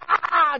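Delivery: very loud, high comic "ah"; mock frantic stuffing cry. Contestant cramming bananas into his mouth, mock frantic. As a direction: yell through an imagined mouthful.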